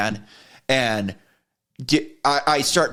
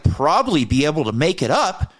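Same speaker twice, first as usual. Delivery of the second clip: higher, exasperated retold rant; indignant rant; 6 semitones higher than usual. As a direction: throw her words back at her. Mocking her words angrily.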